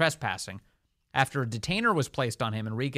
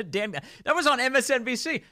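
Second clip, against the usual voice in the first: laughing; 12 semitones higher than usual. Real, not acted. Laughing after the clip.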